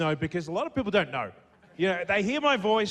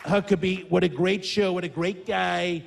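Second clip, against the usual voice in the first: boastful American politician impression, drawing out key words; emphatic showman president with stretched superlatives; Donald Trump. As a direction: brag to the crowd in punchy, repeated phrases. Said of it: "now I've got Donald Trump".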